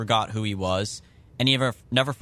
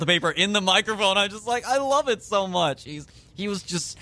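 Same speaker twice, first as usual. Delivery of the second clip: getting emotional; 8 semitones higher than usual. Real, not acted. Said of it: "God, I'm getting emotional again".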